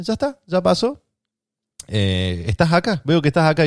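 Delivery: mucho más aguda, como a un nene; hablándole a un niño. Retold or imitated. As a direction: consolar como a un chico de cinco años. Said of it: "como si tuviera cinco años yo". (enfermera hablándole como a un nene, voz muy aguda)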